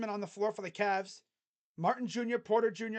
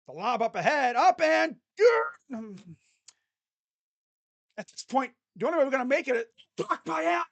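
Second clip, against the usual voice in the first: higher and louder, rushing to the block; excited call; 5 semitones higher, 9 dB louder than usual. Real, not acted. Fan calling the block live, rising excitement. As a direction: burst with excitement as the block comes.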